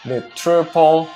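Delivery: normal talking voice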